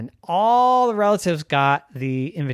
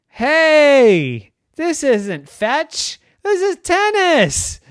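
scolding shout; 11 semitones higher, 8 dB louder, much slower than usual. Acted. Puppy scolding his brother.

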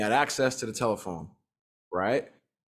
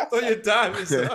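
laughing; 8 semitones higher than usual. Pretend. Joking rallying cry.

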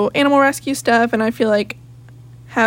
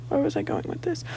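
much softer aside to herself; muttering to herself; 5 semitones higher, 10 dB quieter than usual. Real, not acted. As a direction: mumble it under your breath. Losing her thread.

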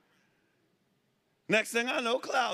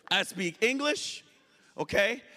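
ordinary talk

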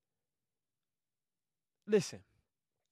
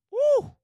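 loud high whoop of joy; shouting woo, hyped; excited woo; 11 dB louder than usual. Real, not acted. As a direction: let out a big woo. Excited cry.

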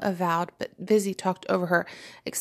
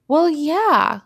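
high, loud 'isn't it obvious' reply; as if obvious; 9 semitones higher, 8 dB louder than usual. A narrator's reading. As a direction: say it like it's self-evident. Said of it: "replied as if it were obvious".

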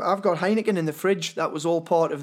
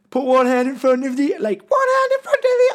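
high, loud mimicked film line; impossibly high squeaky impression; 9 semitones higher, 6 dB louder than usual. Friend imitating David Beckham's one film line, very high voice. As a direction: push the imitation up into a strained squeak. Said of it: "I can't even go that high".

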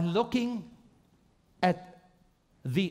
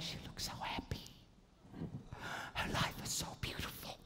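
breathy awed whisper at a photo; hushed longing over someone's picture; envious whisper; 16 dB quieter, faster than usual. Retold or imitated.

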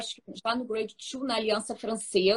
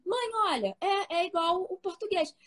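voz de criança imitada; 8 semitones higher than usual. Retold or imitated. Filha citada falando com a mãe.

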